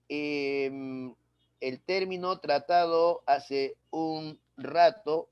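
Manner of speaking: usual speaking voice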